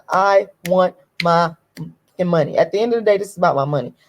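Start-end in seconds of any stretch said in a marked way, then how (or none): 0.1-2.6 slow, drawn-out demand, word by word; deliberate slow demand; slow insistence. Real, not acted. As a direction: spell out the demand slowly. Demanding what she's owed.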